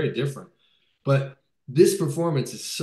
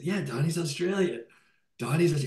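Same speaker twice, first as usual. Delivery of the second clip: pushing the point, half-shouted at the end; mock-insist the actor plays Australian; insistent exclamation; 5 semitones higher than usual.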